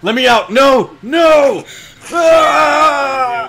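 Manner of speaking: screaming. Pretend. Player screaming as the character is trapped. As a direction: scream to be let out.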